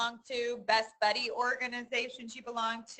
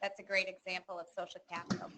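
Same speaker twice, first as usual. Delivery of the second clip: usual speaking voice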